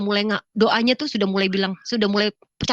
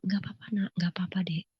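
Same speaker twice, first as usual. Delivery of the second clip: soft, soothing comfort; tender, hushed and composed; calm reassurance; 8 dB quieter than usual. Retold or imitated. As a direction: quietly console a crying sibling. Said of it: "Saya tenang aja".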